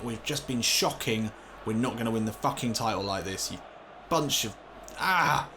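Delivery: normal talking voice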